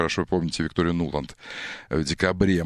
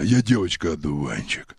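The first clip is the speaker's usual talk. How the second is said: disguised deep voice; 3 semitones lower than usual. Shown as a demonstration. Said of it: "Вот так, да".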